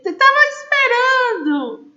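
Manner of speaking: high and loud, laughing through the words; laughing disbelief. Real, not acted. Teacher laughing at student Emily's joke, high amused disbelief. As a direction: squeal it, amused and exasperated.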